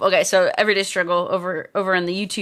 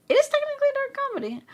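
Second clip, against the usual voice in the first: much higher, fast mock voice; squeaky quick voice; much faster than usual. Streamer reading a chat remark in a squeaky voice. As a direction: rattle it off in a squeaky voice.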